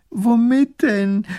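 high, slow, loud wail; sobbing. A narrator's reading. Widow answers the princess's suggestion, sobbing. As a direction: wail it tearfully. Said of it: "fängt wieder an zu schluchzen".

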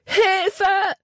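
high, drawn-out cry for help; loud scream for help. A narrator's reading. Libby falls down the gorge in the water, screaming loudly. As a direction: scream it out as you fall. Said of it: "schrie laut".

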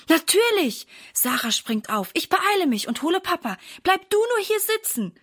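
put-on high, quick, eager child voice; eager girl. A narrator's reading. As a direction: rush off to get help. Sarah jumps up eagerly.

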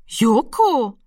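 astonished. A narrator's reading. Frau Lenzen sees the cat suddenly, astonished. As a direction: greet an unexpected visitor with amazement. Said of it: "sagte sie erstaunt".

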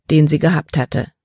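plain reading voice

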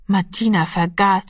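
ordinary delivery

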